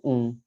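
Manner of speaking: ऊं as a falling nasal vowel; nasalised vowel. Shown as a demonstration. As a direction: end ऊं in the nose without an M. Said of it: "नाक पे, नाक के". (ऊं, नाक से निकलता स्वर)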